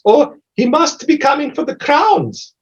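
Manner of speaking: high, slow giantess voice; lilting high voice of a lady giant; female giant voice. Acted. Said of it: "The, the female giant goes".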